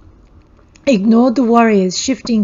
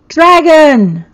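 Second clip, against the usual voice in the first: loud, high scream; 7 semitones higher, 10 dB louder than usual. A narrator's reading. Valga screaming as the dragon appears, loud and high. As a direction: shriek the warning. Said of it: "Valga's scream revealed all".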